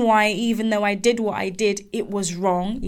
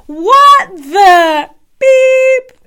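much higher, louder, slow stunned shriek; shocked manager; 14 semitones higher, 12 dB louder, much slower than usual. Retold or imitated. Manager reacting to the CEO incident, stunned shriek. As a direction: let the disbelief explode.

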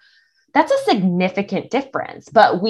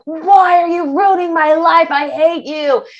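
higher, louder hammed-up yell; mock scream at the rain; 9 semitones higher, 5 dB louder than usual. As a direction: rage at the sky, half joking. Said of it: "I scream at the rain".

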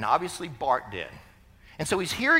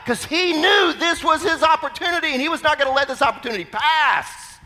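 high, loud, fervent declaration; full-force preaching; 11 semitones higher, 7 dB louder than usual. Acted. Preacher declaring the beggar's faith in the Messiah, fervently. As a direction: proclaim it with total conviction.

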